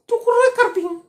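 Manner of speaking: lisping friend. Retold or imitated. Friend complaining to the sister in a high lisp. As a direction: lisp it out like a whiny boy. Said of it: "तुतलाता कभी-कभी था".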